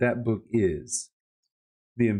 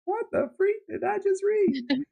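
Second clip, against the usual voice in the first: much higher, incredulous "what did I just read"; baffled exclamation. Retold or imitated. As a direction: squeal it out in disbelief. Imagined baffled reader reaction.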